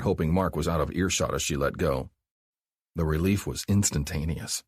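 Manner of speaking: ordinary delivery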